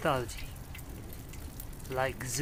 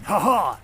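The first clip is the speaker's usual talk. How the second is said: triumphant laugh; 2 semitones higher, 7 dB louder than usual. Real, not acted.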